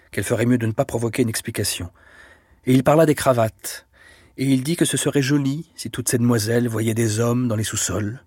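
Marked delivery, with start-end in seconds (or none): none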